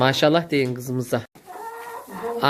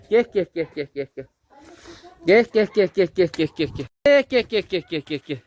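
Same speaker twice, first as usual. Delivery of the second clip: calling chickens; 6 semitones higher than usual. Real, not acted. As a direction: call the hens to come. Calls the chickens. (tavuklara yüksek sesle seslenme)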